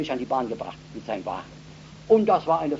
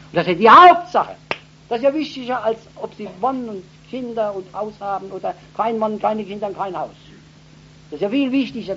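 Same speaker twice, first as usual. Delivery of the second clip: much higher and louder, urgent appeal; fervent preaching; 9 semitones higher, 9 dB louder than usual. Acted. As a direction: press the point home like a revival preacher. Preaching at the woman. (eindringliche Predigt, hoch und laut)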